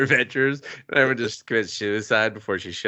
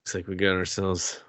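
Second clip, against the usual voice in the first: lightly voiced noir detective; 4 semitones lower, faster than usual.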